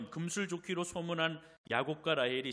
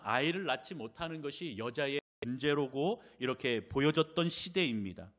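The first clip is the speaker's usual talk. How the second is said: usual speaking voice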